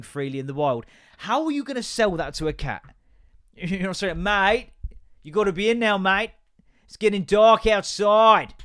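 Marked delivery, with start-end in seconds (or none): 4.2-8.5 loud, higher Australian drawl with elongated words; Australian accent. Acted. Australian owner telling the cat to be in, mock Australian accent. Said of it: "elongated all their words to make the accent".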